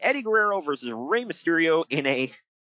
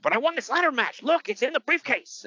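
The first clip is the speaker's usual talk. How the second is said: mock Eddie protest; 10 semitones higher than usual. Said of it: "He's like".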